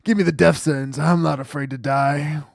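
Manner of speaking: hammed-up doomed defiance; melodramatic client. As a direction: demand death like a tragic hero. Voicing the client's dramatic plea.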